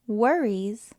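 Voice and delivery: usual speaking voice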